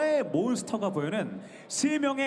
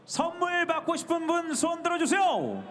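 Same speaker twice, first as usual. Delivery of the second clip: calling out; 10 semitones higher than usual. Real, not acted. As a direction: call out to the whole hall. Host calling out to audience.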